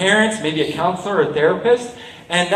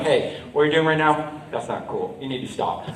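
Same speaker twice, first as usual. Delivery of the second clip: low, softer, steady and calm; calm and firm; 4 semitones lower, 6 dB quieter than usual. Shown as a demonstration. Telling a bully to stop, calm and firm. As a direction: set a boundary without heat. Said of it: "by staying calm and going like".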